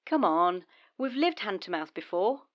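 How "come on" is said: loud insistent come on; forceful urging. Acted. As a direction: push hard, pleading. Urging partner to go freelance.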